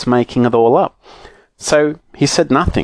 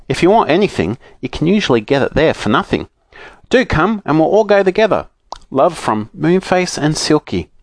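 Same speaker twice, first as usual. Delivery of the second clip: higher, lively letter-reading voice; reading letter aloud; 6 semitones higher than usual. A narrator's reading. Children reading Moonface and Silky's note, reading aloud. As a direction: read the note out in a lilting raised pitch.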